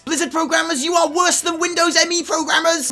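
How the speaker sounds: high-pitched, loud outraged forum poster; hammed-up insult at full pitch; mock furious rant. Player insulting the game's programmers, mock furious. Reading out irate forum complaints.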